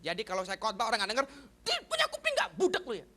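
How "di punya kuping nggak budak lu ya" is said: high-pitched mock scolding; fierce scolding. Preacher imitating his old self at an inattentive congregation, fierce scolding. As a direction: tear into someone not listening. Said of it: "galaknya bukan main".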